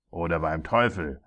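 mildly bellowed threat; bellowing. A narrator's reading. Tyrant threatening the hesitating dwarf, bellowing. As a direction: threaten him angrily. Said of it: "brüllte der Unhold".

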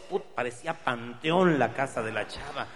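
ordinary talk, nothing marked

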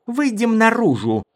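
loud, high-pitched angry command; fierce high order; snarled order. A narrator's reading. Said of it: "зарычала Мара".

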